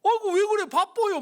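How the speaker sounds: high, loud frightened protest; scared refusal. Retold or imitated. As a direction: shrink back in fright. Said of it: "막 겁을 내더라고요". (겁먹은 듯 높고 크게 거절)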